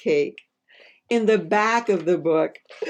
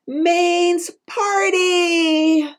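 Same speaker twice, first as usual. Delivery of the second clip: drawn-out high cheer; slow, soaring cheer much higher; excited shout; 13 semitones higher, 6 dB louder, much slower than usual. Acted. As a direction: stretch it out like a party shout. Exclaiming book's big line.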